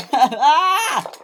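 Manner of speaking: high, loud cry; alarmed yell. A narrator's reading. Luke racing off the rails, alarmed yell. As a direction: cry out while hurtling forward.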